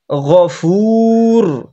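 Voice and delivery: গফুর with a deep throaty gh, slowly; deep ghain. Shown as a demonstration. Teacher shows the deep ghain in গফুর. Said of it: "গভীর করতে হবে গইনটাকে".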